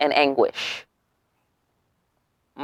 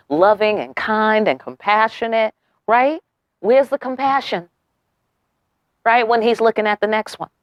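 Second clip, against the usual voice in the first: ordinary delivery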